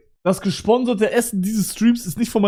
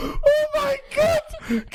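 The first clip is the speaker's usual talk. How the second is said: shrieking laugh; 6 dB quieter, much slower than usual. Real, not acted. Cracking up.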